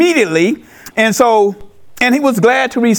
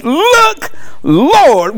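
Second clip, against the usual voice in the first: exclaiming; 5 semitones higher than usual. Retold or imitated. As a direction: call it out with an exclamation point. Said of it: "with an exclamation point".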